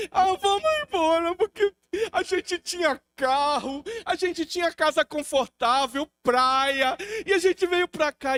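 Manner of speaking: high, loud, slow tearful pleading; mock-sob his wife begging to go home; wife begging her husband to leave, tearful and high; wife's crying plea. E minha esposa chorando.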